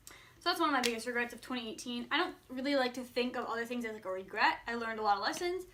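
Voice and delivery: normal talking voice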